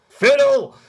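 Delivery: very high, loud long-distance call; yelling back. Acted. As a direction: holler to someone far off. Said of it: "yell back to Fiddle".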